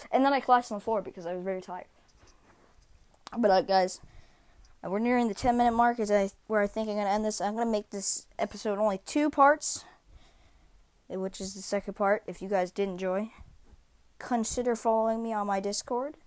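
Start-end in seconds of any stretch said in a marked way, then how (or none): none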